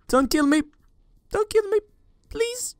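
high-pitched desperate begging; terrified pleading. Real, not acted. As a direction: beg for your life. Begging the killer.

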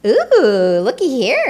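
loud, delighted ooh; excited exclaim. A narrator's reading. Tacky finding the clothes, loud delighted exclaim. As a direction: call out in glee at the find. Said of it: "exclaimed Tacky".

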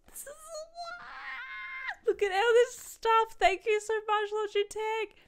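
very high, drawn-out squeal into loud thanks; excited squeal. Real, not acted. Creator thanking Logitech for the haul, squealing excited. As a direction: squeal with delight, high-pitched.

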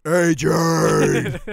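huge, booming drill-sergeant shout; Marine Corps voice. Retold or imitated. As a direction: bellow the name like a Marine. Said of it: "at the top of my lungs with my, uh, my most, uh, profound Marine Corps voice".

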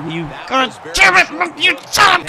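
exasperated swearing; frustrated cursing. Real, not acted. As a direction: curse at the game, climbing in pitch. Cursing at the game.

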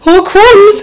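high loud startled cry; frightened exclamation. Acted. Snake startled by the gruffalo, crying out. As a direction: gasp it out in alarm.

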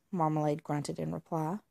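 usual speaking voice

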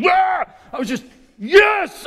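reliving the goal; ecstatic. Retold or imitated. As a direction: burst out with pure joy. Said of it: "I was so excited".

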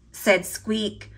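ordinary delivery

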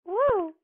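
loud, very high "woo"; sudden falsetto-high cheer; high whoop. Real, not acted. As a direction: let out a short high whoop. Whoop during match.

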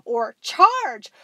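a mock monster war cry; dinosaurs yelling. A narrator's reading. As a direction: bellow the charge. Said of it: "trio of dinosaurs yelling".

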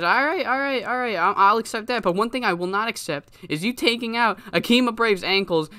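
high-pitched confrontational promo; indignant wrestler. Acted. Chris calling out Pablo in the ring, indignant. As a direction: concede impatiently, then lay down the accusation.

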